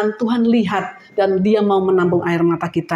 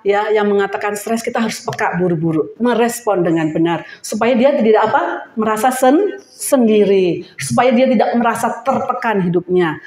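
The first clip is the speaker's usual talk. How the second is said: usual speaking voice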